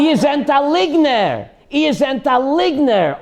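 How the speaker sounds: high-pitched imitated yell; retold yelling. Shaking rabbi calling the woman out, retold yelling. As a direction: accuse her as the outraged rabbi. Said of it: "and yell at her".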